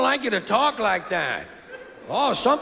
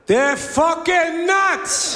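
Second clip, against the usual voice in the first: mock outrage; 7 semitones higher, much slower than usual. Punchline escalation, slow and loud.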